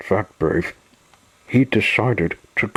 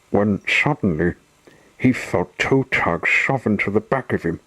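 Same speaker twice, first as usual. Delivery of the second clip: plain reading voice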